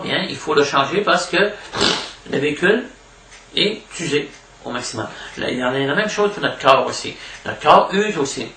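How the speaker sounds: ordinary delivery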